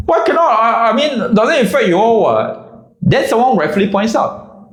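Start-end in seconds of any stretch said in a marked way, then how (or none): 0.1-2.9 high, whiny indignant question; whiny defence. Retold or imitated. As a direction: caught and arguing back. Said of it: "He's like".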